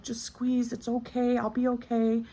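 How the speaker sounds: high, sing-out soothing imitation; reassuring tech. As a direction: imitate a helper gently coaxing a patient. Surgical tech reassuring the patient.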